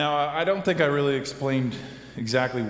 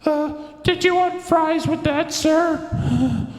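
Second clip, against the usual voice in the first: much higher, louder cashier voice; squeaky fast food worker; 5 dB louder, much slower than usual. Acted. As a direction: pitch way up like a nervous cashier. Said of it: "maybe the voice for that guy's really a little bit more something like this".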